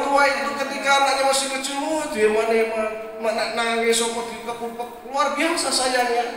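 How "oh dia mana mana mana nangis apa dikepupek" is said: put-on softer, tender fussing over a child; soothing a baby. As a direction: hush a crying infant gently. Parent soothes crying baby. (suara lembut orang tua menenangkan bayi)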